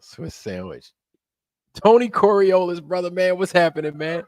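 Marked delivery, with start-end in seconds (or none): 1.8-4.2 excited greeting. Real, not acted. Streamer loudly greets a returning viewer in chat, excited. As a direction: shout out a happy hello to a buddy.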